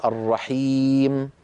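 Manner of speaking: الرحيم with the long ii held; slow recital example. Shown as a demonstration. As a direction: stretch the ya in الرحيم. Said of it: "كل هذه الياءات". (مد الياء في الرحيم)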